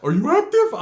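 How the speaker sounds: high, loud, hyped-up challenge; Ricky. Retold or imitated. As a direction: yell like an over-eager gangster. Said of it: "you got Ricky over there".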